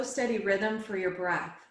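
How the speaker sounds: normal talking voice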